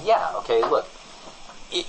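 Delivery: normal talking voice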